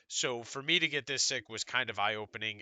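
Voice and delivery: ordinary talk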